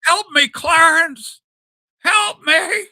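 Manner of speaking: high, slow, loud shaking cry for help; desperate plea. Retold or imitated. Host acting out George Bailey begging Clarence. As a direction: beg the angel like a broken man. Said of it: "he's shaking".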